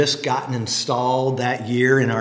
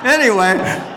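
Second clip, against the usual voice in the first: laughing; 7 semitones higher, 4 dB louder than usual. Real, not acted. Laughing off the joke.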